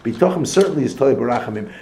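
usual speaking voice

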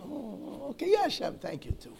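high-pitched, half-hearted acknowledgment; offhand afterthought. Acted. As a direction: toss in thanks as if nearly forgotten. Acts thanking God as an afterthought.

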